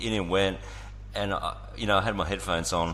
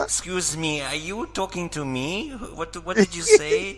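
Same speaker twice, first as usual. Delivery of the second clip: much higher, slow mock-puzzled voice; baffled woman imitation; 10 semitones higher, 3 dB louder, slower than usual. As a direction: draw it out as a mimicked confused woman. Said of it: "That was Shane trying to communicate. She was like".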